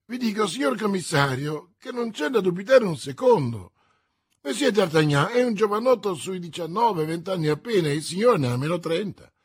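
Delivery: high, loud insistent argument; exclaiming. A narrator's reading. Prisoner proving the mistake to the commissioner, agitated insistence. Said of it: "esclamò a sua volta Bonacieux".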